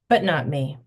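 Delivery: plain reading voice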